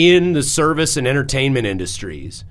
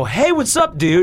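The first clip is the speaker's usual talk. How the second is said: cheery greeting; 6 semitones higher than usual. Retold or imitated. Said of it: "and I go".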